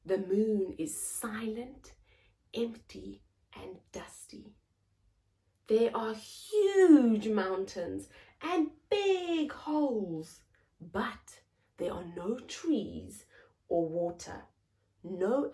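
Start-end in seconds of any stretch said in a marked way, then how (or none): none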